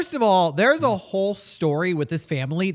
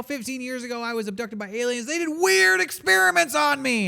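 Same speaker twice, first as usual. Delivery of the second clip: high-pitched crazy ranter, building to loud; crazy guy; 8 semitones higher, 3 dB louder than usual. Acted. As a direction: rant like the town crackpot, rising to a yell. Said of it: "He's the crazy guy".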